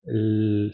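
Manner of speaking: L as a long voiced hum; L sostenida. Shown as a demonstration. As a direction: hold the L so the throat vibrates. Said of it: "Si yo me quedo en la L". (L alargada y vibrante)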